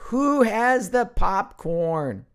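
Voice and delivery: high, loud, slow drawn-out call; playful call-out. Pretend. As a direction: sing out the question to the crowd. Calling out for popcorn.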